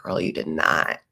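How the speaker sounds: playfully deep disbelief; mock deep sass. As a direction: drop the voice low and call her out. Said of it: "I wanna be like".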